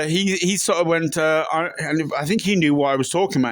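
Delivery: ordinary delivery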